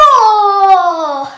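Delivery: loud lion roar. Shown as a demonstration. Teacher counting the push-ups with a loud lion roar. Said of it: "and then you go roar".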